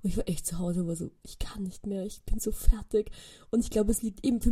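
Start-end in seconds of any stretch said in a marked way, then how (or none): 1.2-3.1 exhausted whisper. Retold or imitated. Exhausted after uni.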